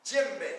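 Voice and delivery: Djembe said clearly as JEM-bay; teacher modelling Djembe. Shown as a demonstration. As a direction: sound out Djembe, stress on JEM. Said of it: "who remembers the name of this drum".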